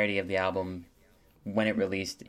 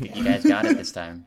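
playful emphasis; 8 dB louder than usual. Acted. Teasing hosts for getting names right.

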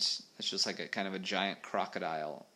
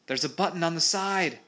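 high-pitched urgent call; shouting; 9 semitones higher, 7 dB louder than usual. A narrator's reading. Molly telling Peter how to open it, shouting. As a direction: call out an instruction in a raised pitch. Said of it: "shouted Molly".

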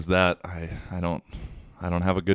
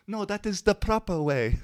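high-pitched French-girl imitation; mock French girl insisting, high voice; terrible French accent; 13 semitones higher than usual. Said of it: "my French accent's terrible".

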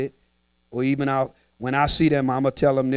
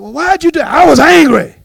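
high, loud quoted back-and-forth; mock exchange; 10 semitones higher, 12 dB louder than usual. Someone asked why, answering loud and high. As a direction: act out the excuse loudly. Acted exchange about anger.

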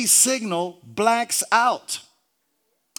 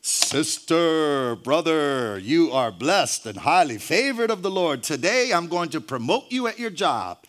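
God's voice; 5 semitones lower than usual. Acted. God promises a churchgoer a promotion, lofty low voice. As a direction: imitate God blessing a favored believer. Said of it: "We all expect God's voice to say".

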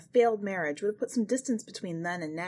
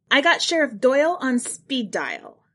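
loud, higher call to the crowd; raised warning; 6 semitones higher, 7 dB louder than usual. Acted. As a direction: wave them off with a loud threat. Cousin warns the crowd off.

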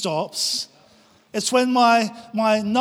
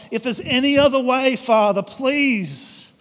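pleading prayer; 4 semitones higher, slower than usual. Acted. Jesus pleading with the Father.

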